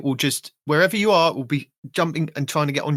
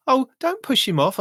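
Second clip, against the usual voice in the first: much higher mock plea from the wife; imitating wife; 10 semitones higher than usual. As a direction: squeak out the wife's protest. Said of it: "And my wife goes".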